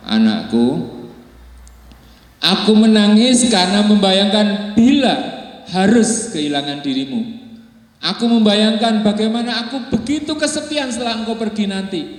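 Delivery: high, tearful father's lament; a father's voice near tears; grieving father. Acted. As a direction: plead like a father about to lose his son. Grieving father answers his son.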